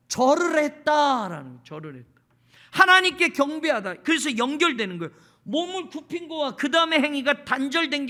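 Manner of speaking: fervent preaching. Acted. Pastor preaching to his congregation, fervent. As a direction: drive the point home from the pulpit.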